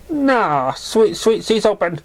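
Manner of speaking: high, happy chirp; cheerful and quick to help; a bright, happy mare; happily. Acted. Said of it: "she said happily".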